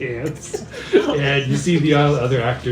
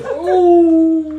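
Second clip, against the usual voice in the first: long, high, loud howl; dog howl; 11 dB louder than usual. Acted. Dog, best actor of the troupe, joining in with a high howl.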